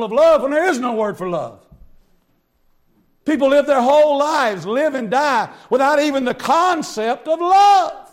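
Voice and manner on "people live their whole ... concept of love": usual speaking voice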